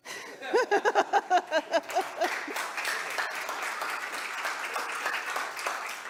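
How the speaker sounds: a long breathy real laugh. Real, not acted. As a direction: laugh it off, a little moved. Laughs after saying I'm not alone.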